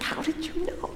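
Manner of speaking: acted stunned whisper, voiceless; hushed, breath-only amazement; astonished whisper. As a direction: whisper it as if found out. Acting Thomas astonished at Jesus.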